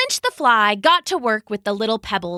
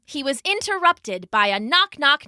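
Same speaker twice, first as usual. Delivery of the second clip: plain reading voice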